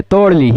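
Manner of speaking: तोड़ ली with a flapped ड़ instead of र; mock a student reading तोरली as तोड़ ली. Shown as a demonstration. छात्र की नकल में तोरली को तोड़ ली कहना. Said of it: "तोड़ली सूत्र है".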